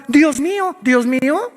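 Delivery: imitating frightened cry. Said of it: "ateos que gritaban".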